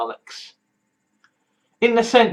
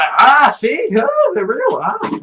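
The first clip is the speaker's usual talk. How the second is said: excited squeal; 8 semitones higher than usual. Retold or imitated. Mock fan squeal over ship.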